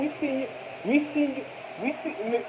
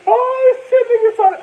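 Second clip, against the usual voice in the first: laughing hard, much higher and louder; loud laughter; 12 semitones higher, 10 dB louder than usual. Real, not acted. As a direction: burst out laughing, then a high loud "oh shit". Bursts out laughing retelling shootout.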